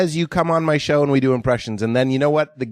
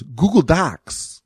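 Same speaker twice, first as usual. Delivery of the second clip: slow, drawn-out Walken Google Docs; Walken-style slow Google Docs; Christopher Walken impression; 3 semitones higher, much slower than usual. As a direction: stretch each word like Walken. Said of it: "Christopher Walken saying one thing".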